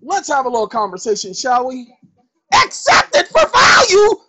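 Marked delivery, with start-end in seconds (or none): 2.5-4.2 high, loud mock doubter's shout; skeptical listener. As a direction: cry out the question like a disbelieving outsider. Skeptic voiced in a lecture.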